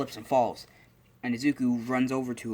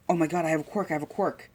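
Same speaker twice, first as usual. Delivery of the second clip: put-on high, thrilled kid's outburst; excited child; 6 semitones higher, faster than usual. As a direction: burst out with childlike amazement. Acting delighted child discovering power.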